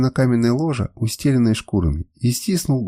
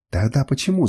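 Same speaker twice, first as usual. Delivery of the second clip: ordinary delivery, nothing marked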